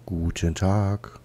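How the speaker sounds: slow, low, drawn-out hello; drawn-out mock greeting. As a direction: stretch the greeting out slowly. Gegner gedehnt begrüßen. (langsam gedehnte Begrüßung)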